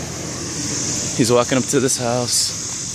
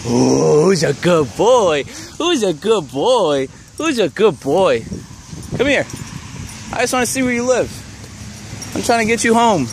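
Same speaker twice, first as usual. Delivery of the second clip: calling a lost pet; 7 semitones higher than usual. Real, not acted. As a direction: coax the dog home, pitched up. Coaxing a lost dog.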